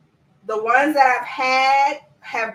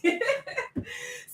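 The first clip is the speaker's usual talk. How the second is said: high giggle; 11 semitones higher, 6 dB quieter than usual. Real, not acted. Laughing at her own joke.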